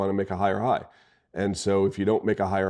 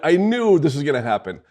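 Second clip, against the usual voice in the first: mock rant; 5 semitones higher, 5 dB louder than usual. Real, not acted. Ranting about college hockey.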